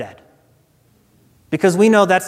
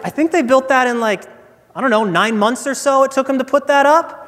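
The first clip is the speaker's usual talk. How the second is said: lightly voiced guide, pitched higher; tour guide guessing; 6 semitones higher than usual. Tour guide answering a boastful Texan, lightly voiced pondering. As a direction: think aloud, hesitating over the estimate. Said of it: "he thinks, he's like".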